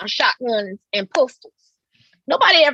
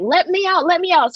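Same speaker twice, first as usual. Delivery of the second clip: higher pleading call; mama locked in; 6 semitones higher than usual. Retold or imitated. Locked-in mother begging daughters, high pleading. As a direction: plead to be let out, pitched up. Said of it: "She was like".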